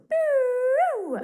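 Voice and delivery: high, held rocket-like "pew"; high "pew" whoosh. Shown as a demonstration. Teacher showing a conversation taking off, high whoosh.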